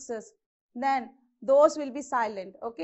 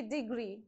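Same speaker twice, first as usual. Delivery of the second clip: Degree with more stress on the second syllable; deGREE, weight on the end of Degree; stress on gree; 5 semitones lower, 9 dB quieter than usual. Shown as a demonstration. Teacher showing correct pronunciation of Degree, stress on gree. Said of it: "So on gree, you have to put more stress".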